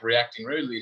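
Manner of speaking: ordinary talk, nothing marked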